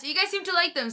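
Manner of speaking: ordinary delivery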